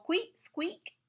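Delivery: high squeaky "squeak." like a dolphin. Acted. Reading to kids, imitating a dolphin squeak.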